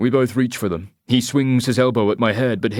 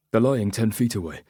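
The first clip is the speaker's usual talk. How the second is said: usual speaking voice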